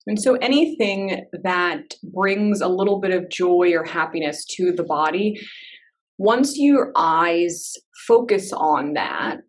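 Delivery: unhurried meditation guide with long pauses; calm, spacious instructions, leaving silence between phrases; guided meditation. Real, not acted. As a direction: ease listeners gently into body awareness.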